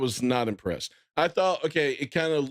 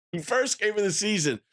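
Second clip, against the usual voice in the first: ordinary talk, nothing marked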